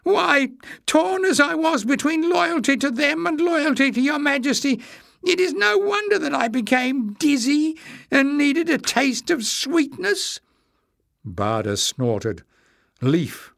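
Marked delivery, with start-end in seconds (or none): 0.0-10.4 very high, wheedling whine; high-pitched self-justifying; whining. A narrator's reading. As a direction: whine an excuse in a squeaky voice. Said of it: "whined Jinx to Leaf".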